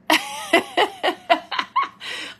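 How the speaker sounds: breathy self-conscious laugh; airy laugh. Real, not acted. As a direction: laugh it off lightly. Laughs at own ignorance.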